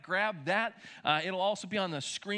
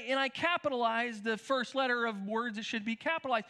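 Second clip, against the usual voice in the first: ordinary delivery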